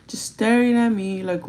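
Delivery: normal talking voice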